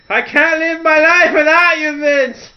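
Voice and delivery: high, loud mock wail in a put-on voice; hammed-up heartbroken plea; distraught wife. Wife Linda begging after divorce.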